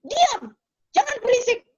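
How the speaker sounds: high, scolding retold parent snap; short, high-pitched mock scolding; mama snapping at child. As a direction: act out a mother telling off a small child. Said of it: "mamanya bentak dia".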